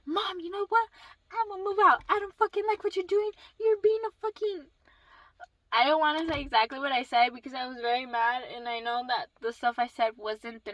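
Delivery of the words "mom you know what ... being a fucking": high-pitched mock yell; retold screaming. Daughter announcing she's moving out, retold angry yell. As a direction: act out yelling at mom, held back. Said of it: "screaming like a fucking crazy".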